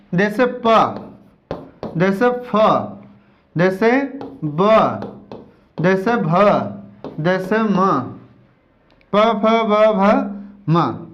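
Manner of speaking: "प", "फ", "ब", "भ", "म" said slowly, one by one; sounding out letters. Shown as a demonstration. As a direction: sound out each of "प", "फ", "ब", "भ", "म". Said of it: "आप उच्चारण करके देखिए इसका". ("प फ ब भ म" धीरे-धीरे अलग-अलग उच्चारण)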